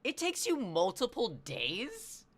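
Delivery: high, loud, drawn-out disbelief; real incredulous rant. Real, not acted. Streamer marvelling at how big Texas is, incredulous. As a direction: stretch the words in loud exasperated amazement.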